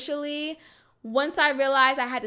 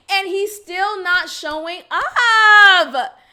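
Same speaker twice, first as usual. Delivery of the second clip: slow, louder, voice cracking with crying; near tears; 5 semitones higher, 8 dB louder, slower than usual. Real, not acted. Telling viewers he never shows, crying. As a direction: let the hurt break through slowly. Mock self-harm rant, breaking.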